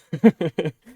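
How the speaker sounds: loud laugh at himself; burst of laughter. Real, not acted. Laughs at own remark.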